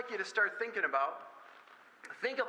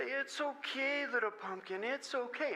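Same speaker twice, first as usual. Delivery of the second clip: slow, soft and higher; soothing a toddler; 6 semitones higher, 3 dB quieter, slower than usual. Retold or imitated. Father comforting his crying daughter, slow soft coo. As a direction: hush her gently, draw it out.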